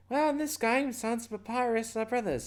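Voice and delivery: high put-on character voice; high game-character exposition voice; Toucher explaining. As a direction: pitch it way up and explain matter-of-factly.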